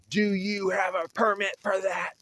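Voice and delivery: high mocking whine of a pushy bureaucrat; mocking the official. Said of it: "He says".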